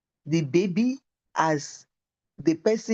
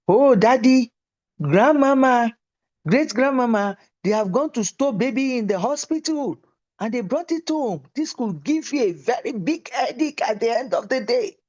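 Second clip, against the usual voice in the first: put-on higher, excited little girl reporting the news; child's voice; 5 semitones higher than usual. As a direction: play the proud small daughter announcing it. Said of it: "he said".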